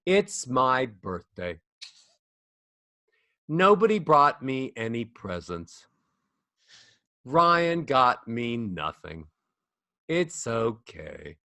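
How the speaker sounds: slow, sinking at every line end; sad falling melody. Shown as a demonstration. Coach showing a descending, mopey melody. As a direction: let each sentence droop down sadly. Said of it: "melodies go down... makes people feel sad".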